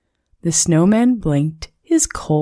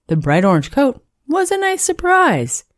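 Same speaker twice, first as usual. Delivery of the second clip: ordinary delivery, nothing marked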